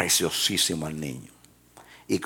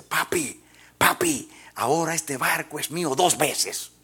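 put-on happy child; 8 semitones higher than usual. Boy tells father happily.

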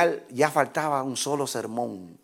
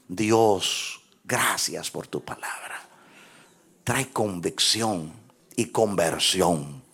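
prayer; 7 semitones lower, 4 dB quieter than usual. Acted. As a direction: pray gently and earnestly. Leads closing prayer.

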